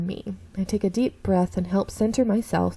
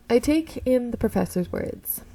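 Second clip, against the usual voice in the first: ordinary talk, nothing marked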